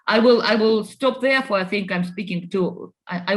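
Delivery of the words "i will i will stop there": normal talking voice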